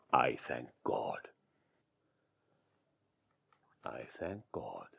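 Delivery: a private prayer of relief; hushed thanks. Acted.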